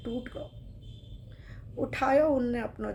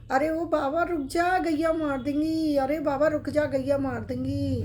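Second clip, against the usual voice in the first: put-on loud, higher boyish call, slowed on the cow lines; calling out a warning; 4 semitones higher, 5 dB louder than usual. Young cowherd boy shouts at the old devotee to stop, loud urgent call. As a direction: shout across the road to stop the old man. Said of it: "अब वो चिल्ला रहा है".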